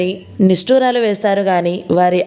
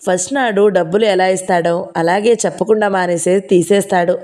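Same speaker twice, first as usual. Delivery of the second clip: ordinary delivery, nothing marked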